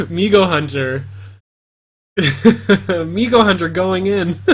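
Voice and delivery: laughing through the name; slow, laughing delight. Real, not acted. Laughing at player's move.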